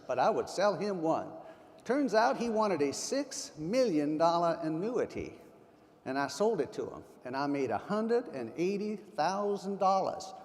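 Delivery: imitated Texan storyteller, stretched-out words; thick Texas drawl. Speaker imitating Charlie telling the client annuity story, thick Texas drawl. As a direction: tell it in a drawn-out Texas drawl. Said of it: "he said in his thick Texas drawl".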